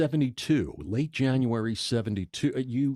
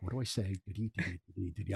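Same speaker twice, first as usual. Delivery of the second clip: nervous inner voice; 4 semitones lower, 10 dB quieter, much faster than usual. Retold or imitated. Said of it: "So it was like".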